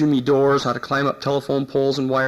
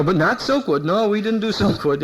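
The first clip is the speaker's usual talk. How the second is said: higher, hasty protest; nervous denial; 5 semitones higher than usual. Retold or imitated. Cornered cop nervously denying Silkwood, higher hasty protest. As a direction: deny it fast with a nervous laugh.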